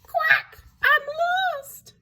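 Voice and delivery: tiny, very high duckling squeak, fading; high little quack dying away to a whimper; small sad voice. A narrator's reading. Lost duckling in the woods, small sad voice. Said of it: "a small, sad voice".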